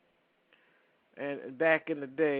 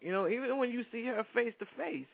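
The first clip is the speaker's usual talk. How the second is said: ordinary delivery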